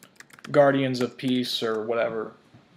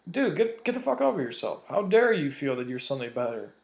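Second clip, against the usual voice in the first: usual speaking voice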